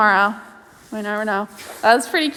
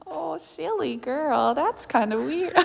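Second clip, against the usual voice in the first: cooing at horse; 4 semitones higher, 8 dB quieter than usual. Real, not acted. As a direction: coo fondly at the pony.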